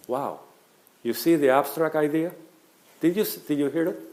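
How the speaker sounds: normal talking voice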